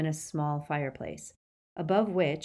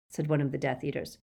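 ordinary delivery

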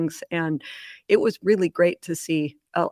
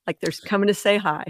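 ordinary talk

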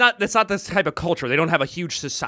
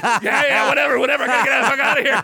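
laughing dismissal; 8 semitones higher, 4 dB louder, faster than usual. Retold or imitated. Woman brushing him off.

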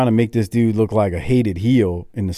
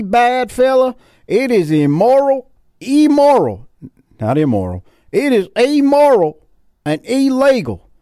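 angry Southern fan; 10 semitones higher, 3 dB louder than usual. Retold or imitated. Southerners warning about Johnny B. Bad, loud high mock indignation. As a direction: preach it like an outraged Southern local. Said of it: "That's what the Southerners were saying".

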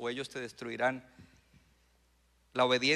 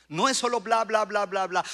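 loud, higher-pitched mock chatter; dismissive blah-blah; 5 semitones higher, 9 dB louder than usual. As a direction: rattle off empty babble, loudly. Mocks empty prayer chatter.

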